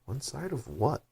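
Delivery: high, puzzled wondering; puzzled thinking aloud. Acted. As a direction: puzzle over it under your breath. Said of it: "thought Alice to herself".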